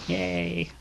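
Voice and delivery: a little happy cheer for herself; soft cheer. Acted. Young woman welcoming a new companion, soft and pleased.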